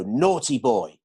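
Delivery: stern, reproving tone; scolding. Shown as a demonstration. Trainer mock-scolding a colleague to show tone, scolding. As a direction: tell off a naughty child firmly. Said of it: "change the meaning of the words with the tone".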